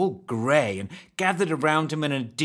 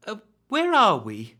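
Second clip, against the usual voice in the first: lightly voiced girl; 10 semitones higher, 5 dB louder than usual. Lost girl asks a stranger.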